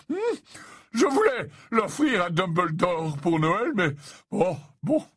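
high, hesitating host; put-on professor voice, pondering aloud; jovial old professor. Slughorn listing his bottles for the boys, jovial and musing.

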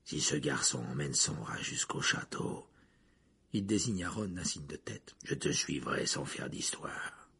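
low-pitched, restrained bargaining voice; low, grim prisoner. Acted. Prisoner offers to surrender, low and grim. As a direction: keep it low, slowing at the end.

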